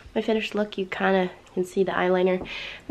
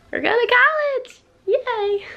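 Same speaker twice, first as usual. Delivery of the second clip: excited cheer. Real, not acted. Excited shout after whisper.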